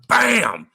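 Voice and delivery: high, loud "bam" for a crash; explosive storytelling sound effect; loud crash imitation. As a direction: punch it out like something crashing down. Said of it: "it comes crashing down".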